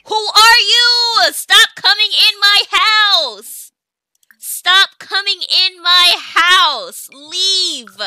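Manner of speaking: loud, slow, drawn-out yells; angry shout. Real, not acted. Gamer yelling at Sims invading her house, angry shout.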